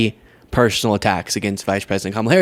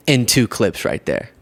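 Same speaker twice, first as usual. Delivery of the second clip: ordinary talk, nothing marked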